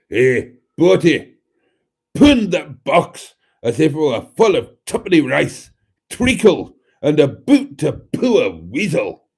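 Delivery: loud, hammed-up Scottish accent; generic Scottish accent. Streamer reading a listener's request aloud in a loud generic Scottish accent. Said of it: "in this generic Scottish accent".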